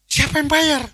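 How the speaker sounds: high, loud amazed question; astonished. Retold or imitated. Groom stunned the bill is already paid, shouting. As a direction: gasp out who paid.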